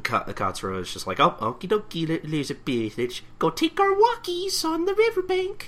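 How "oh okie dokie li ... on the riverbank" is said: very high silly cooing; cutesy pet talk. Acted. Katsura taking Elizabeth for walkies, hammed-up cutesy voice. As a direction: coo at a pet in a hammed-up falsetto.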